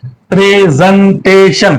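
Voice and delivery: Presentation slowly in four beats, pre, sen, ta, tion; slow, segmented presentation; split into syllables. Shown as a demonstration. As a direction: break presentation into four separate parts. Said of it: "कितने हिस्से हो गए भाई".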